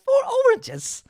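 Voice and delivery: squeaky, very high, loud "Four oranges?"; outraged disbelief in a put-on voice; mock shock. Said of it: "And she's just".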